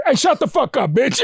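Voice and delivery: high-pitched, exasperated telling-off; mock mad. Viewer to repeat offender, mock mad. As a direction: act out patience running out the third time. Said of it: "you get mad at 'em".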